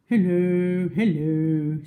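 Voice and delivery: big, loud hippo greeting; Humpy Rumpy hippo. Acted. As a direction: boom a jolly hello. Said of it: "said Humpy Rumpy".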